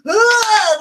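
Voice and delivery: loud, long disgusted groan. Retold or imitated. Groan the whole drive.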